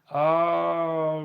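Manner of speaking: a loud, drawn-out oh of mock realisation; exaggerated oh as the penny drops. Real, not acted. Streamer's loud mock realisation at a troll's name, drawn-out oh.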